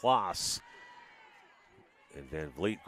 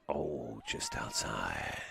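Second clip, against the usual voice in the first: low, slow trailer-guy voice; movie trailer voice; 9 semitones lower, 9 dB quieter, slower than usual. Real, not acted. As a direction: drop into a deep trailer voice. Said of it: "Sound kind of like a movie trailer guy".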